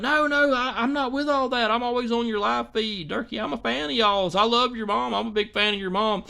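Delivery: lightly voiced protesting lady; mock pleading fan.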